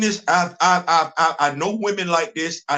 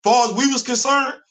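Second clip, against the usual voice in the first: ordinary talk, nothing marked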